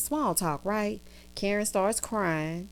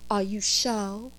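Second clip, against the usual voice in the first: teasing; 3 dB quieter, slower than usual. Retold or imitated. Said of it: "I love to do that to Karen".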